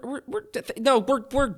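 usual speaking voice

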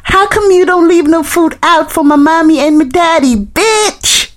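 a tiny bug telling her off with attitude; sassy baby roach. Retold or imitated. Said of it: "they were like".

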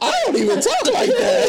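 very high, squealing laugh through the words; laughing protest. Retold or imitated. Comic objecting to being mimicked by a friend, laughing protest. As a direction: protest it while cracking up, pitch shooting up.

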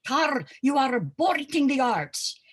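high, loud Russian accent imitation; stern Russian ballet teacher accent; Madame Swoboda's Russian accent. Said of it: "this is her quote with her Russian accent".

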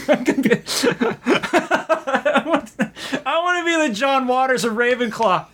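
giggling through the words; can barely get it out laughing; laughing while talking. Real, not acted.

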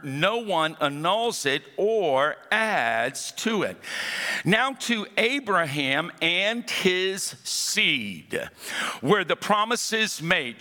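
slow, solemn reading of the verse; slow scripture reading. Acted. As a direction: let each phrase hang.

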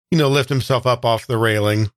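ordinary talk, nothing marked

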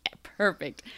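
whispered approval. Retold or imitated. Psychic accepting necklace.

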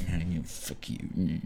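low, slow grumble under the breath; mumbled cursing. Retold or imitated. Frustrated subway rider cursing to himself, mumbled. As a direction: mumble it to yourself, barely audible. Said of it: "mumbling to myself".

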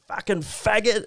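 imitating a hostile stranger yelling from a car; mock yobbo yell. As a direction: sneer it out the car window like a passing bogan. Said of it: "and he goes".

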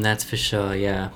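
ordinary talk, nothing marked

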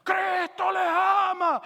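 high, drawn-out preaching holler; long, loud call to a crowd; shouting. Acted. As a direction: yell it from an upper floor without a microphone. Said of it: "empieza a gritar".

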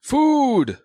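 high, loud bellow; hungry monster shout. Acted. Cyclopes aiming crossbows at Ivan, shouting. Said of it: "you hear them shouting".

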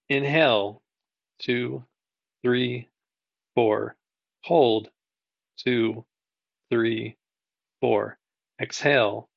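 calm, slow counting with long pauses; slow breath counting. Shown as a demonstration. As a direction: count the breaths gently, guiding the class. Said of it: "So let's do that together".

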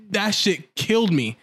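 ordinary delivery

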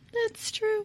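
playful high-pitched repeat of a concession; high squeaky echo. Real, not acted. Conceding a point about the candy commercial, comically high. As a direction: jump far up in pitch.

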